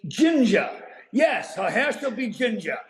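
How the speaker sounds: gleeful ad-lib. Acted. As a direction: relish the made-up punchline and crow it out. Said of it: "I was having such a good time".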